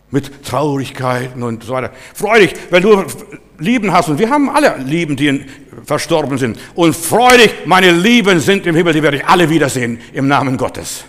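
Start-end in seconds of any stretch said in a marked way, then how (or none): 2.1-11.0 loud, higher, punched key words; forceful preaching. Real, not acted. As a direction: proclaim it to the congregation. (mit Nachdruck gepredigt)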